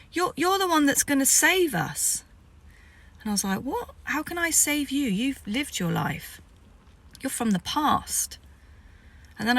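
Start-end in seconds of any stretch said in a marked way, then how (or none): 0.1-2.2 excited chief. Retold or imitated. Chief declares her the saviour. 3.6-8.4 baffled disbelief. Retold or imitated. She answers the chief in startled disbelief, high then slowing.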